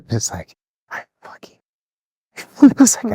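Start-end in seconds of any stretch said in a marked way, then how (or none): 0.9-1.6 much softer, whispered curse; bitter whispered aside. Retold or imitated. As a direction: hiss it under your breath. Recruit's silent fury.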